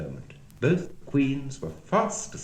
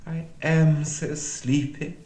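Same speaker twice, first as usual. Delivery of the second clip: slow, drawn-out moan, pitched up; sleepy moan; 6 semitones higher, 3 dB louder, slower than usual. A narrator's reading. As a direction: moan it slowly, heavy with sleep. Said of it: "she moaned".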